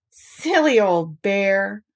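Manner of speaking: slow loving whisper. Acted. Christopher Robin comforting stuck Pooh, loving whisper. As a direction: whisper it fondly, slowly. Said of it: "in such a loving voice".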